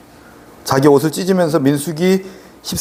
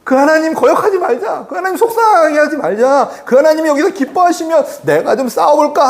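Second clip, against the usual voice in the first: high-pitched, fervent urging; rally the doubters with raised, insistent pitch; acted-out rousing appeal; impassioned exhortation; 6 semitones higher than usual. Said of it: "얘기를 하고 있는 거죠".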